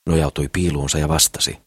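plain reading voice

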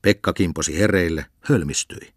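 usual speaking voice

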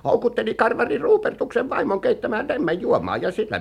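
excited scandal-telling; scandalized gossip. Acted. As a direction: tell the scandal with rising relish. Gossip relishing a scandalous tale.

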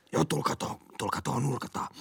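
whispered, secretive invitation to the corner; hushed quote. Retold or imitated. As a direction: lure them over in a hush. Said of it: "Ja se on".

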